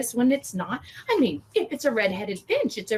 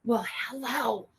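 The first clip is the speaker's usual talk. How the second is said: normal talking voice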